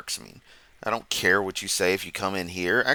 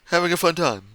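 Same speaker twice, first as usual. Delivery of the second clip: playful; 9 semitones higher, 3 dB louder than usual. Real, not acted. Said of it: "laughing at my own jokes".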